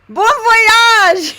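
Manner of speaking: high, full-volume holler; imitating a man hollering clearly; triumphant unmasked shout. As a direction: bellow the farewell to be understood at last. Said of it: "pulls down his mask and he goes".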